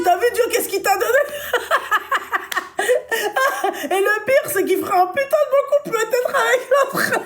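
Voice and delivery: high, fast teasing through giggles; laughing while talking. Real, not acted. Friend laughs retelling how she teased her boyfriend. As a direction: tease him, cracking up.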